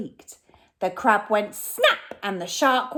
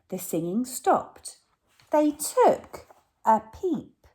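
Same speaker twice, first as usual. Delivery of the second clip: ordinary delivery, nothing marked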